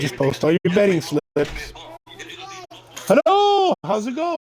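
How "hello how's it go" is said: high greeting. Acted.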